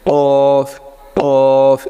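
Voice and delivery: কফ drawn out on a steady note; Arabic qaf. Shown as a demonstration. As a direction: stretch the vowel of কফ, ending in f. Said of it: "এরকম বলতে থাকবেন". (আরবি ক্বফ, লম্বা টেনে)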